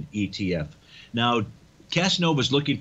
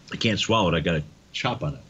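ice in mouth; 6 semitones lower, faster than usual. Real, not acted. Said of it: "there was an ice cube that slipped out".

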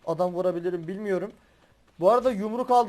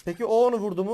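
ordinary talk, nothing marked

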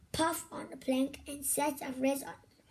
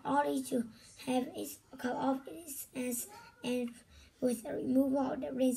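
plain reading voice

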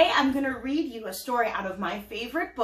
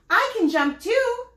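much higher, louder eager call; 9 semitones higher, 5 dB louder than usual. Acted. Pearl calling to the jumping girls, eager. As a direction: burst in wanting to join the game.

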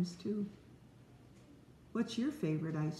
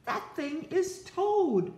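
much higher, slow reveal; astonished realization; 10 semitones higher, 4 dB louder, slower than usual. Acted. Frog tells himself the thing is Toad, slow and amazed. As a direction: draw out the surprise.

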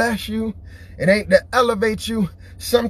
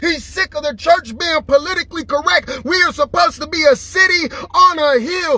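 preaching; 6 semitones higher than usual. Acted. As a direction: preach it hard to the crowd. Preaching at full force.

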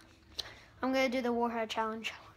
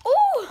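high squeal then laugh; sour squeal; 12 semitones higher, 9 dB louder than usual. Real, not acted. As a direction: yelp at the sour taste. Reacting to sour candy.

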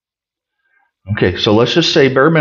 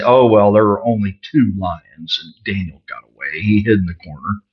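dismissive mock-voice of the critics, lower and quicker; 7 semitones lower, 3 dB quieter than usual. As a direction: brush it off like a smug sceptic. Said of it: "and say".

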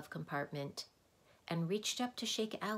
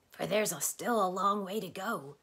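Tok's voice; 3 semitones higher, 6 dB louder than usual. Acted. Tok's voice continues.

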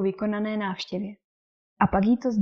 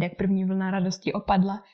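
ordinary delivery

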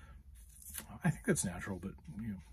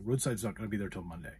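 quick, unsympathetic delivery, imitated; imitating a brisk, indifferent manager; matter-of-fact; faster than usual. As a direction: rattle off the bad news with no apology. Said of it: "It was this matter of fact".

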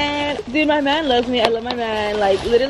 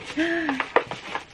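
surprised gasp; 4 semitones higher, 8 dB quieter than usual. Real, not acted. Opening mom's gift.

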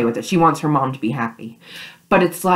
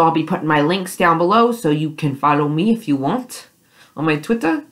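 ordinary delivery

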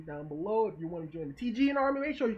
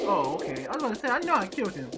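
ordinary talk, nothing marked

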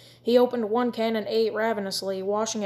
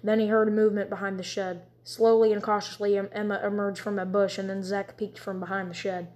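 plain reading voice

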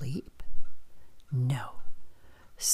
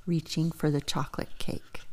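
ordinary delivery, nothing marked

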